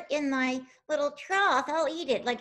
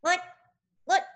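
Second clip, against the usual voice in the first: ordinary talk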